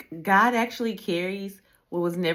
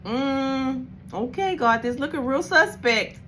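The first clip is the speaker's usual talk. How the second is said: mock suspicious; 7 semitones higher than usual. Skeptical talk to God.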